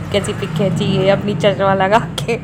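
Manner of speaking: quoting the daughter while laughing; words pushed out between giggles. Real, not acted. Mother repeats daughter's line about glasses photo, laughing. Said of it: "वो बात इतनी हंसी आ रही थी".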